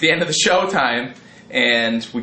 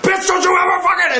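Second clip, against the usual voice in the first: much higher, louder retold yell; furious yell; 4 dB louder than usual. Friend yelling at the girl he shoved, furious yell. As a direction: scream it in a rage at her.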